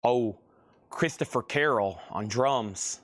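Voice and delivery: ordinary talk, nothing marked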